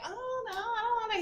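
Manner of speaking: put-on high whining kid voice; whiny kids. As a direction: whine it like a reluctant child. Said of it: "the kids was like".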